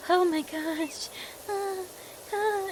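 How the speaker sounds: high, breathy flustered squeak; flustered girl. Retold or imitated. Imitating a shy companion getting flustered over kindness. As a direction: act like a shy girl caught off guard. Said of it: "she'd just be like".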